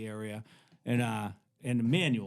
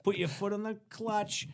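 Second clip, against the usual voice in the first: higher, lightly voiced instruction; imitated driving coach; 8 semitones higher than usual. Hippie to teen stopped on steep hill, imitated coaching. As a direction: pitch it up and urge them on.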